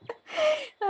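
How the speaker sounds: high, squealing laugh; helpless high-pitched real laughter; amused laugh. Real, not acted. Storyteller amused by aunty in the bundle, laughing. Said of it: "मुझे हँसी भी आ रही है".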